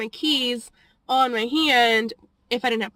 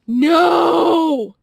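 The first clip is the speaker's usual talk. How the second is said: loud, higher no; emphatic shout; 5 semitones higher, 8 dB louder than usual. Acted. Vlogger cutting off her own hopeful guess, loud shout. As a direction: blurt it out to kill the hope. Sudden twist in getting-fired story.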